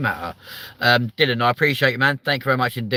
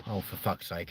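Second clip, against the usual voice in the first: ordinary talk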